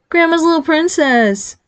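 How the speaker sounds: put-on high, slow, teasing baby talk; sing-song baby-talk tease. A narrator's reading. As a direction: coo it mockingly, stretched out. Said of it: "Nick adopted a baby voice".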